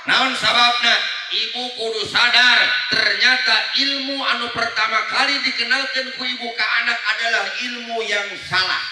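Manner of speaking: loud, pressing sermon voice; forceful preaching. Acted. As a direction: drive the point home loudly. Preacher raising voice to mothers.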